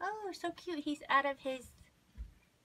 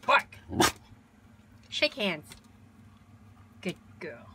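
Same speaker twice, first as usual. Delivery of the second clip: firm dog command; 4 semitones lower than usual. Real, not acted. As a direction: snap the word Bark out at the dog like an order. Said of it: "She's so obedient".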